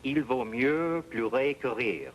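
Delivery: "Il vaut mieux pleurer que rire" said slowly with careful French sounds; French saying. Shown as a demonstration. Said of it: "there's a saying".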